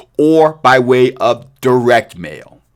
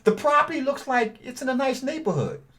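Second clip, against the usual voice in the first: higher, softer seller-friendly ask; friendly caller script; 10 semitones higher, 8 dB quieter than usual. Shown as a demonstration. Buyer calling a seller, raised and gentle. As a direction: sound like a polite buyer on the phone.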